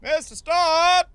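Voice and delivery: high, drawn-out call from afar; high-pitched holler to summon the boss; distant yell. Acted. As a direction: yell the name slowly across the fields. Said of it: "Is that guy yelling your name?".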